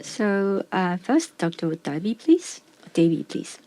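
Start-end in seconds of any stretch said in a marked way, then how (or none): none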